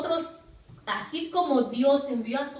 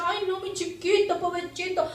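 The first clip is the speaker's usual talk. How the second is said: mock pitying parent; 6 semitones higher than usual. Dios imaginado compadeciendo al Hijo.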